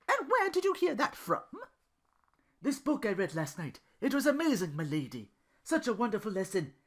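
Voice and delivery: lightly voiced banter between two characters; voiced dialogue. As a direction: trade lines as eager Duke and dry Belle.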